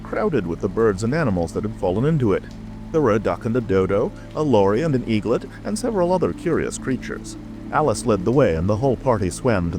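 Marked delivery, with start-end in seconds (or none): none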